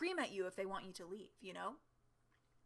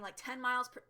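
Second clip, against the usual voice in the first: ordinary delivery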